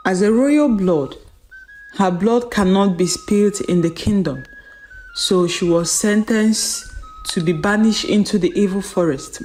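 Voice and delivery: usual speaking voice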